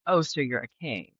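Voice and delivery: ordinary delivery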